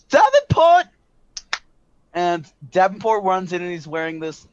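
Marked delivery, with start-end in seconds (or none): 0.1-0.8 very high, loud summons; calling out yell. A narrator's reading. Director summoning Davenport, loud high holler. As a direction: holler the name across the base. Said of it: "she yells".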